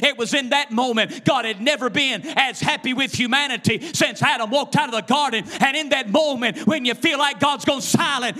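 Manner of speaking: high, loud, driving sermon delivery; full-force preaching. Real, not acted. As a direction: preach it hard in rhythmic bursts.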